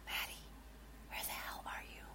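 breathy whisper into the dark room; whisper, barely voiced, afraid. John whispering into the empty attic for his brother. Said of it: "He whispered".

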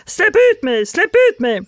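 high-pitched, loud cry for release; small captive creature squealing; trapped spirit. Acted. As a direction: pitch it way up and yell. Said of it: "Det här är min andra".

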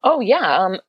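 ordinary delivery

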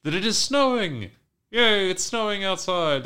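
gleeful imitated yay; joyful. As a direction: cheer it out like kids seeing snow. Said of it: "discovering to their joy".

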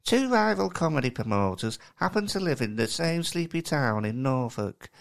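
West Country Alan Bennett, mocking the co-host; Kai's accent. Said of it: "impression of me doing an impression of you".

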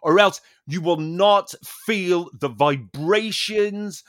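slow, earnest mock-guru explanation; a hint of the instructor's preachy slowness. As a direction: draw it out like a solemn fitness guru. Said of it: "he was going".

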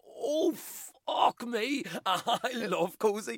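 high, slow impression of a mum; man doing a woman's voice. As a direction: drawl it out like a cozy mum. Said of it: "Was that an impression of your mum?".

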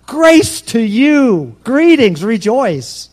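very slow, high, loud grace to you; angel's greeting. Acted. Angel greeting Mary, drawn-out loud proclamation. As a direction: stretch every word like a heavenly herald. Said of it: "The angel".

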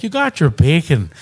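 loud mock Irish cooking host; Irish accent. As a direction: imitate an Irishman giving a recipe, high and loud. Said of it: "he's got, he's like".

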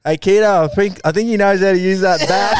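high, loud teasing call through laughter; mock the bowler, loud and laughing; laughing jeer. Slips fielders taunt the bowler, loud and laughing. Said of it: "the boys in the Slips go".

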